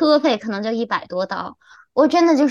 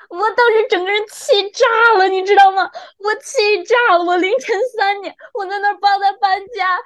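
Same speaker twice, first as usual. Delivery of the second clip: high, loud, fed-up venting; real exasperation, raised and loud; exasperated rant; 7 semitones higher, 5 dB louder than usual. Real, not acted. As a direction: pour out the outrage, pitch way up.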